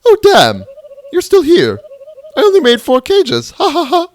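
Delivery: loud, high panicked laughing; flustered queen. Acted. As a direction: babble in giddy royal panic.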